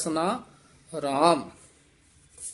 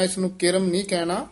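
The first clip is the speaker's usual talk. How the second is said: ordinary talk, nothing marked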